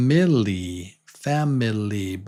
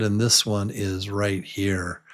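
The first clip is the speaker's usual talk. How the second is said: ordinary talk, nothing marked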